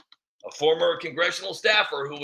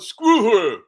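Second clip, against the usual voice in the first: higher, louder mock defiance; pissed-off Eric; 7 semitones higher, 5 dB louder than usual. Eric defies Nancy after being told no, mock angry defiance. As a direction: puff up and defy her in a put-on voice. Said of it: "Eric was probably pissed off".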